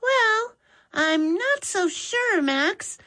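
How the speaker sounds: put-on boy doubting his brother's plan; Mitch's doubtful voice. A narrator's reading. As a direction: push back gently like a skeptical little brother. Mitch doubts napkins as plates.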